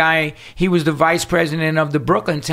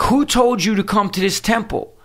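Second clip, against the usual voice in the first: mock hostile question; 5 semitones higher than usual. President repeats hostile question.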